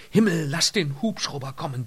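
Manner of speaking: high, urgent plea to heaven; pleading prayer. A narrator's reading. Lynott begs heaven for the helicopter, praying urgently. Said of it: "betete sie".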